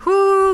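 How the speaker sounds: high shaky whew, fighting back tears; shaky sigh. Real, not acted. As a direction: blow out air to stop from crying. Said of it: "I'm trying not to cry".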